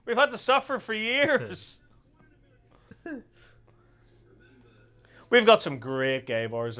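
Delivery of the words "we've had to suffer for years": high, hammed-up wail of agreement; mock long-suffering. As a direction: lament it like a weary martyr, played for laughs. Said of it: "It's like".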